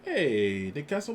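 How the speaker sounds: ordinary talk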